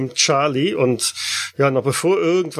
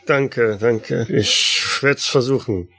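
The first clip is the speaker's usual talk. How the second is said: ordinary talk, nothing marked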